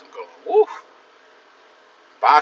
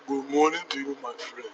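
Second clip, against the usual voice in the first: deep African accent; 6 dB quieter than usual. Shown as a demonstration. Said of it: "put your voice mad deep".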